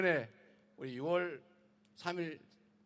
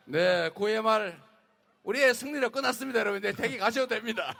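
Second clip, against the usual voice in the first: triumphant; 5 semitones higher, 10 dB louder than usual. Real, not acted. Candidate declares victory, joking.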